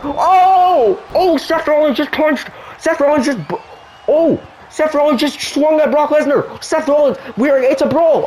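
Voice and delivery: real shock at the punches; excited commentary. Real, not acted. Brawl breaks out in game.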